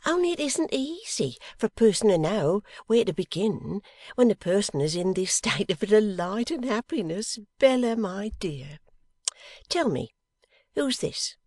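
high, lilting voice of a doting wife; fond, joyful motherly chatter; Mrs Boffin, delighted. Acted. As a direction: overflow with happiness, pitched well up.

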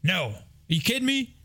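high-pitched mock outrage from dad; incredulous dad. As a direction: imitate a dad balking in disbelief, voice leaping up. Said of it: "my dad goes".

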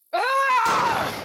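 a high, loud scream of rage; enraged scream. Acted. Tasha screams in rage over the dead body, high raw cry. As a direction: let out a sharp enraged cry.

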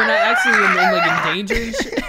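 breaking into high laughter; laughing. Real, not acted. Laughing at own scream habit.

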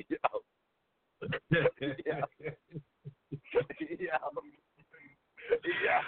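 breaking up laughing between each word; real laughter. Real, not acted. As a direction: laugh helplessly, barely getting the word out. Cracking up at the full price.

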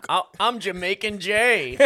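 slow, loud mock Jamaican accent; playful Jamaican voice. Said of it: "Why did you sound Jamaican?".

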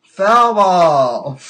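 big mock sports holler; mock umpire call. Calls out mock foul ball.

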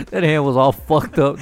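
ordinary talk, nothing marked

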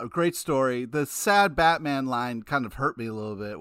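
ordinary delivery

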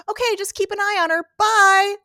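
high, quick, ending on a loud bye; breezy mock send-off. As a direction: imitate someone dashing off carefree. Retold breezy hurried exit line.

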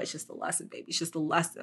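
hushed, half-whispered reassurance to herself; soft whispered inner pep talk; soothing self-talk. Retold or imitated. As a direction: whisper it like calming yourself down. Self-talk pep quote after falling down.

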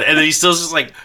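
ordinary talk, nothing marked

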